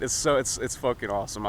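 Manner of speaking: ordinary talk, nothing marked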